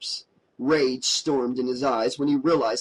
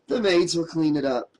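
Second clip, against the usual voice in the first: plain reading voice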